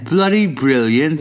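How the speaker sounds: Bloody brilliant in a slow English accent. Shown as a demonstration. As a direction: say it like a Brit, slowly. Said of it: "an English accent?".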